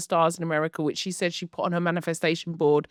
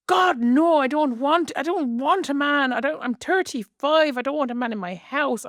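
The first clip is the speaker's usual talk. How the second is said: higher, half-whispered mock Irish accent; imitating an Irish accent; 6 semitones higher than usual. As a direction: do a quick, not-so-good Irish impression. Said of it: "I'll stop doing her accent".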